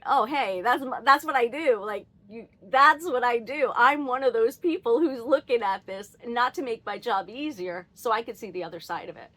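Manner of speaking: half-shouting the reply I never gave; imagined loud retort. Retold or imitated.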